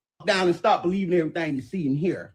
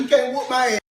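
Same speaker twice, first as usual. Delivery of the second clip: ordinary delivery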